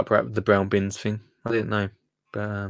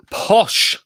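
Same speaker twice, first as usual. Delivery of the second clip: mock posh; 9 semitones higher, 4 dB louder than usual. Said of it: "Posh hedgehog food".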